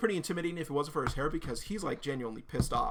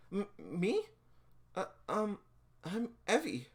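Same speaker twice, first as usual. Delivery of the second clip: higher, hesitant bashful voice; shy stammer; 6 semitones higher, slower than usual. Acted. Shy girl asked her name, flustered. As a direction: falter nervously before giving the name.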